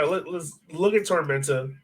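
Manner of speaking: ordinary talk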